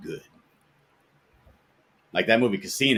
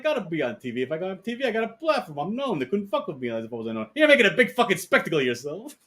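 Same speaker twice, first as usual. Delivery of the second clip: higher, hammed-up gangster squabble; mock mobster argument; 9 semitones higher than usual. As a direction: argue it like the movie mobsters. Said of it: "It's like".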